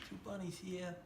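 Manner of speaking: put-on Brooklyn accent. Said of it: "That's your Brooklyn accent".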